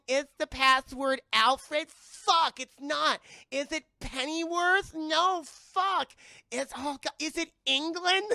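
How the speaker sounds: high, loud mock desperation; hammed-up exasperated hacker, high and loud; frantic password guessing. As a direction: burst out with each failed guess. Acting out failed password guesses.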